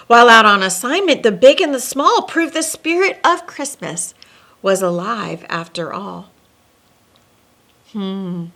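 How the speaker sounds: lilting read-aloud storybook voice; storyteller reading rhyming verse; story narration. A narrator's reading. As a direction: read it like a bedtime rhyme to kids. Reads rhyming Christmas story aloud.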